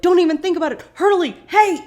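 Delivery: loud, high alarmed yell; urgent shout. Retold or imitated. Jack yells at Hurley as he faints, loud alarm. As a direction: shout to stop him fainting. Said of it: "and Jack is like".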